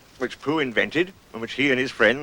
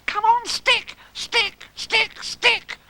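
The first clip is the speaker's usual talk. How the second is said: very high, urgent little chant; high-pitched repeated calls; Roo calling out; much slower than usual. Acted. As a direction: cheer it on in a tiny squeal. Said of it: "calling out".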